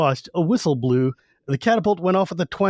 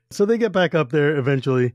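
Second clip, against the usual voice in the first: ordinary delivery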